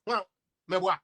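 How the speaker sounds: normal talking voice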